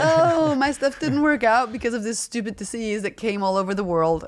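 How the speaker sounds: high, loud mock lament; mock excuse-maker. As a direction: play up a clichéd excuse, starting with a big "Oh". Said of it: "such a cliche to say".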